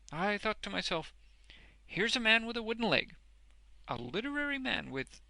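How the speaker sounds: high, forceful character voice; eager, blustering Boffin. A narrator's reading. Boffin tells Wegg what he thought, eagerly. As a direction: push on enthusiastically with your explanation.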